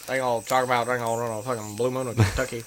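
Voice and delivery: fast, low, mumbled Southern babble; Boomhauer. Acted. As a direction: slur the words into one quick low mumble. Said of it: "I guess Boomhauer is probably the best".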